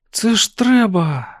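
high, drawn-out wonder; delighted amazement. A narrator's reading. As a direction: marvel at it, slow and high. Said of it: "захоплено сказала Сюзан". (захоплений вигук, високо й протяжно)

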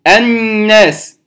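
الناس slow, nasal on the doubled N; ghunna demo. Shown as a demonstration. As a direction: stretch the NN of الناس. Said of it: "هذه نون". (الناس بغنة واضحة)

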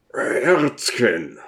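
1 pleading. A narrator's reading.

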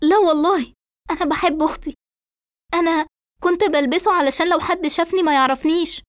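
high-pitched, emotional denial; worked-up suspect defending herself; agitated. A narrator's reading. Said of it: "فترد إسراء بانفعال".

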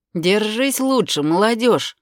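put-on good-natured loud old man; a friendly old man calling out; kindly old man. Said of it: "беззлобно сказал пожилой мужчина".

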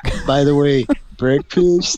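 dopey one-word question; dimwit voice. Acted. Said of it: "He just says break".

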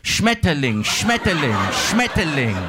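loud, high German bark, falling each time; mock how ugly the word sounds by bellowing it; guttural, bombastic German. Shown as a demonstration. Comedian contrasting German with prettier languages, bombastic mock shout. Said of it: "It's very guttural".

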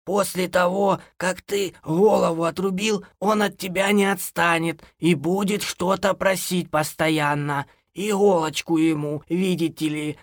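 high, slow scolding complaint; lamenting granny. A narrator's reading. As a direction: grumble on in a high old-woman voice. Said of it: "запричитала хозяйка". (бабушка причитает и бранит)

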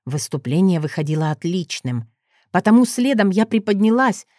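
usual speaking voice

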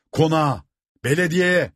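high, drawn rallying shout; rallying cry. A narrator's reading. Rebels urge the crowd to the mansion, crying out. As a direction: call the mob onward, pitched high. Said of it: "diye haykırıldı".

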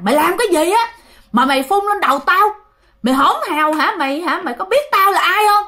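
high, loud furious scolding; furious possessed shout. Retold or imitated. Possessed father yelling at the aunt who spat liquor, furious shout. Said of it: "Mà nói dữ thiệt là dữ luôn".

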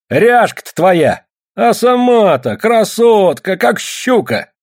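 high, drawn-out jeering abuse; sling insults slowly in a high voice; scolding woman. A narrator's reading. Ksenia answers Nalim's teasing, scolding.

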